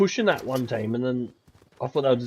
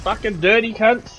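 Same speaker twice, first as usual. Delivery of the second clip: angry outburst; 7 semitones higher, 7 dB louder than usual. Real, not acted. Just got ambushed.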